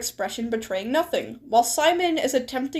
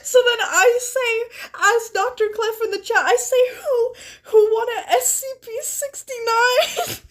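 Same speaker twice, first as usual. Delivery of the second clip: laughing hard; 8 semitones higher than usual. Real, not acted. Streamer typing a joke as Clef, laughing. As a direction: squeak the line out through giggles.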